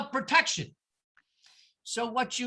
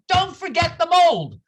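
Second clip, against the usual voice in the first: higher, louder mock yell; mock scream; 6 semitones higher, 7 dB louder than usual. Maureen yelling at Danny Sorkin while fleeing, mock scream. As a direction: yell it over the running crowd. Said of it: "she's screaming at Danny Sorkin".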